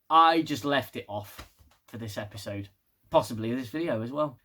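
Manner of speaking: usual speaking voice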